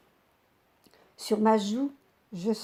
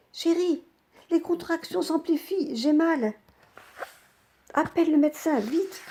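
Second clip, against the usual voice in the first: higher, urgent pleading through labour pain; pained plea; 5 semitones higher than usual. Acted. Wife in labour urges her husband to fetch the doctor, higher and pained. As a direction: beg through the pain, pitched up.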